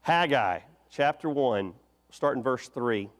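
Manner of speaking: ordinary talk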